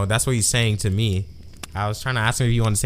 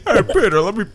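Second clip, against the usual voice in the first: pleased, higher-pitched cat voice; a cat begging to be petted; 5 semitones higher than usual. Retold or imitated. Cat voice again, lewd.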